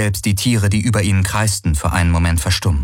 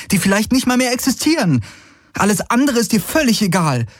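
much higher, pressing and hurt; Kirai, reproachful; 12 semitones higher than usual. Acted. Kirai reproaching Galen that he only cares about Konda, pitched high and hurt. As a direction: accuse him while near tears, voice up high.

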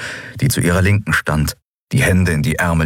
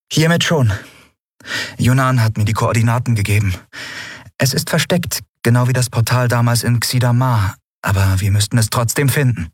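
lightly voiced captain, higher than narration; a hint of a higher-pitched female lead; captain Endriel, assuring; 6 semitones higher than usual. A narrator's reading. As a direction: explain the plan to a doubting crewmate.

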